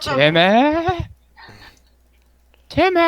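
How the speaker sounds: very high creature squeak; kobold calling out. Acted. Game master as kobold Tim in the cave, high calling.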